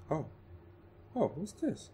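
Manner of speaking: high, delighted surprise; excited surprise. Real, not acted. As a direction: pitch jumps up with delight. Finds an item.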